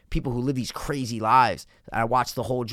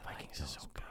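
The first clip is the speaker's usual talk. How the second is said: breathy whispered praise; 11 semitones lower, 23 dB quieter than usual. Real, not acted. Whispering his pick to his friend, hushed whisper. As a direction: whisper it like a secret. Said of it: "whispers recommendation".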